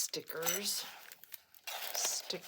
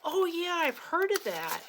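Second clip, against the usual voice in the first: friend's reply; 12 semitones higher, 8 dB louder than usual. Retold or imitated. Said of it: "She says".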